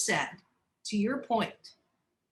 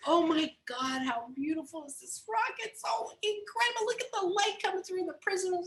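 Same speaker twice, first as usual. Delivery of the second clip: high, gushing admiration; gushing admirers; 9 semitones higher than usual. Acted. Shoppers admire the beautiful right rock, gushing high. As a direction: gush over the rock like a tourist. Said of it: "everybody goes over and says".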